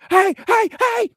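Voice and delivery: high, loud rising "hey"; alarmed shouts. Acted. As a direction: shout a warning as the axe head flies. Calling as axe head flies.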